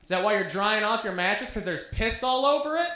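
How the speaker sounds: high-pitched mock holler, climbing at the end; dad yelling. Dad taunts Craig about his mattress, high mock yelling. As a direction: imitate a dad broadcasting an embarrassing question. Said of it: "He was yelling it".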